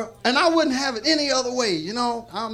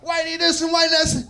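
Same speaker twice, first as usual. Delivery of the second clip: loud, high mock rant about whitey; 6 semitones higher, 4 dB louder than usual. Mimicking a ranting man for the crowd, loud mock shout. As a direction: shout it like a mocking impression of an angry ranter. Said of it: "a motherfucker be talking about".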